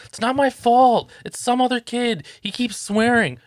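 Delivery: high, slow mock-child protest; imitated protesting kid. Kid defending himself to dad, mimicked high protest. Kid's excuse voiced by dad.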